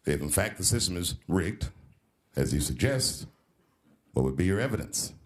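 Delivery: low, measured moderator voice; debate moderator. Retold or imitated. Comedian plays the moderator questioning a candidate, low and formal. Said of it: "And the moderator said".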